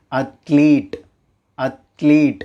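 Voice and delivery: அத்லீட் with a long EE, ath-LEET; correct athlete. Shown as a demonstration. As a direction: stretch the second syllable of Athlete: ath-leeet. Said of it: "உண்மையான pronunciation என்ன".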